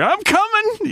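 high hammy "I'm coming"; Redd Foxx "I'm coming". Retold or imitated. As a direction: do Fred Sanford's heart-attack line. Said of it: "I tried to do a little Sanford, little Red Fox thing".

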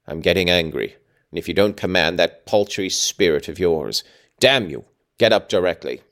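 ordinary delivery, nothing marked

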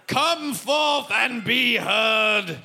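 slow, booming mock-solemn proclamation; mock herald. Host summoning audience questioners, as a mock herald. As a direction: summon them grandly, drawing out every word.

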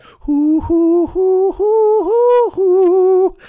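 high hooting mock lute tune; imitated lute. Shown as a demonstration. Comic plays an imaginary lute for the audience, loud high hooting. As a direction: hoot out a silly lute melody, loud and high. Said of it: "I'm actually fingering air lute".

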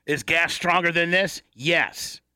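normal talking voice

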